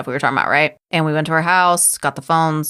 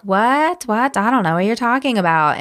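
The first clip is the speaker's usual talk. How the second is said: higher-pitched mock-innocent what; feigned innocence; 6 semitones higher than usual. Retelling the suspect stonewalling the sheriff, mock innocence. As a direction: play dumb, pitched up high.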